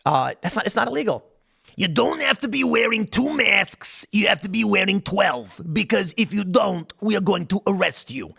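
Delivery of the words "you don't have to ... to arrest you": exaggerated cartoon impersonation, high and slow; Fauci. Shown as a demonstration. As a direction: lay down the rules in a slow, raised caricature voice. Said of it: "maybe my Fauci is better".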